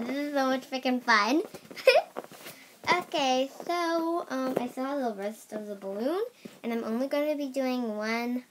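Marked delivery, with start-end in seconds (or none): 1.5-2.5 squeaky laugh. Real, not acted. Laughing after helium.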